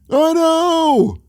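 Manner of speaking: Seinfeld impression. Imitating Seinfeld for a friend. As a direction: drawl it like a standup bit. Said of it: "Or think about Seinfeld".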